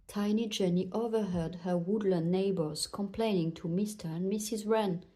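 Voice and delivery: usual speaking voice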